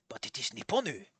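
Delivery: quiet, low fake-Japanese old man; fake Japanese accent. Old man answers the visitor, fake Japanese accent. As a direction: insist softly in a put-on Japanese accent. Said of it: "this man wasn't a real Japanese man".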